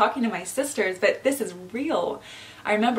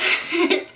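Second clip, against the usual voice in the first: a real, high laugh through welling tears; tearful laugh; 10 semitones higher, 5 dB louder than usual. Real, not acted. Overwhelmed while thanking her viewers, tearful laugh. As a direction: let the emotion out in a laugh. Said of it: "did not expect to get emotional".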